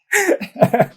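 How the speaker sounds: a high, choked laugh through tears; tearful laugh. Real, not acted. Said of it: "get emotional".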